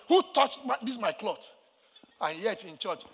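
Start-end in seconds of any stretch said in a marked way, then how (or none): 0.1-1.5 high, loud start, then dropping; mock confrontation. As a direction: challenge them over the touched laundry, hammed-up. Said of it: "you can come and say".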